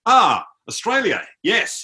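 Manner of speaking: high, loud drawn-out exclamation; imitated delighted recognition. Soldier learns tourist is Australian, imitated delight. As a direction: mimic a soldier's slow, pleased realisation.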